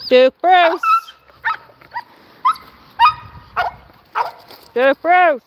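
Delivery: loud shout of a dog's name; real, loud calling out. Real, not acted. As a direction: holler to call a dog back. Shouting the dog's name to call him.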